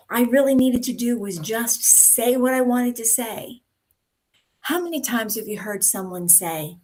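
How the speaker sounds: normal talking voice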